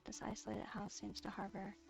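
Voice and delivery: ordinary talk, nothing marked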